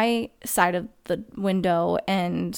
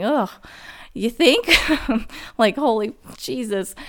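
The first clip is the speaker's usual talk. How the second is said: sarcastic retort; 3 semitones higher than usual. Retold or imitated. Sarcastic reply to Sam.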